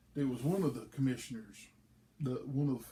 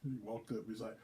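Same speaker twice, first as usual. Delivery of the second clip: usual speaking voice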